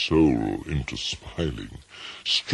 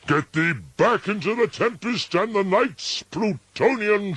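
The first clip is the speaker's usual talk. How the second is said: high, loud raging command; furious shriek; 14 semitones higher, 6 dB louder than usual. A narrator's reading. Narrator orders the raven gone, high and furious. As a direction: drive the bird out with fury. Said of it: "I shrieked".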